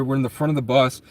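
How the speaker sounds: ordinary talk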